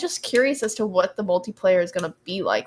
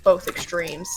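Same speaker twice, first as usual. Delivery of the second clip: ordinary delivery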